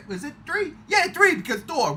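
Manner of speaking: normal talking voice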